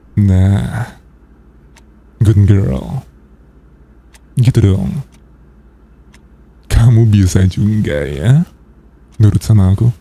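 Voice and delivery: ordinary delivery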